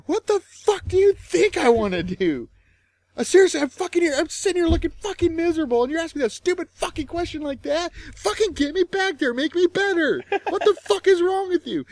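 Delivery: high, loud mock screaming rant; furious outburst. Yelling at the rude nurse, a high loud furious rant. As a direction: shriek your fury at the nurse. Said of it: "I just blew up on her".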